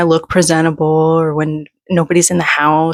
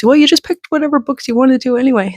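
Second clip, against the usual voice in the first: mocking quote; 7 semitones higher, faster than usual. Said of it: "my feedback was".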